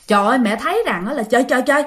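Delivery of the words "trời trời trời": high, fast, frantic cry of terror; panicked cry. Retold or imitated. Mother seeing the ghost carry off her children, frantic high cry. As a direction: sound the panic of a mother losing her kids.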